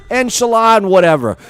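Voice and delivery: put-on thick Jersey accent. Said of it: "he looks at me".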